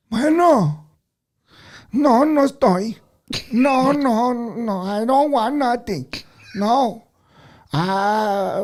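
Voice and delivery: put-on high, loud, slow old man voice. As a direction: play a frail old man on the phone. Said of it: "I do an old man voice".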